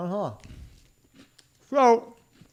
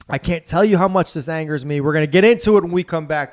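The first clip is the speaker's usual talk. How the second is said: radio host tease; 5 semitones higher, 4 dB louder than usual. Acted. Show break announcement.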